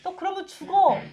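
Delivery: urgent shouted rebuke, half laughing; warning shout. Retold or imitated. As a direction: call out to stop someone falling. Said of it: "소리를 지르죠".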